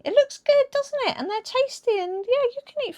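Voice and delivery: high, coaxing snake voice; tempting snake. Retold or imitated. The snake tempting them to eat, in a high coaxing voice. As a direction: sweet-talk them like a sly serpent. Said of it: "the snake's like".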